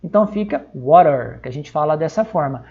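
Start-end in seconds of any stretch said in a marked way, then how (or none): 0.7-1.4 "water" with a tapped T, close to "wa-rer"; American English "water", T softened to a tap. Shown as a demonstration. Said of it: "é esse rá".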